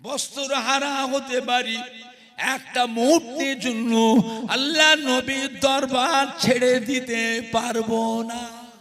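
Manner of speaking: loud, ringing sermon delivery; fervent waz preaching, loud; preaching at full force. Acted. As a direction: proclaim the vow to the crowd. Preacher's chanted defiant vow.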